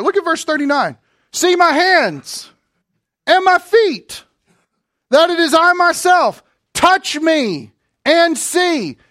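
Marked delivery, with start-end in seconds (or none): 1.3-9.0 slow, higher, louder plea as Jesus; Jesus pleading. Acted. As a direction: lift the voice and stretch every word, begging them to touch. Preacher voices Jesus inviting touch.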